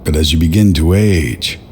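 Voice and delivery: normal talking voice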